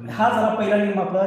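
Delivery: usual speaking voice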